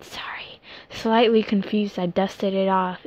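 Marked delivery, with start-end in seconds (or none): 0.0-0.6 whisper. Acted. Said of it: "she got up quickly".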